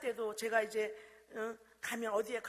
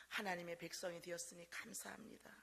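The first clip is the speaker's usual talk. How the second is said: whispered, much softer reading; 6 semitones lower, 11 dB quieter than usual. Retold or imitated. Grandmother reading the child's offering envelope, in a whisper. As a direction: hush it like a secret treasure.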